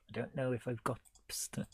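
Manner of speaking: ordinary talk, nothing marked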